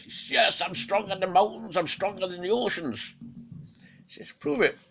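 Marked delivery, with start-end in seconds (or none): 0.3-3.1 Fenrir boasting, higher and louder; boastful wolf. Acted. 4.3-4.8 much higher, louder dare; challenging Odin. Acted.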